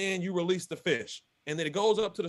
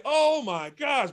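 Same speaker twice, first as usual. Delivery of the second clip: slow, higher, louder with delight; thrilled relief; 6 semitones higher, 4 dB louder, much slower than usual. Retold or imitated. Angler after landing a big fish, slow thrilled gasp. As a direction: stretch it out as the luck turns.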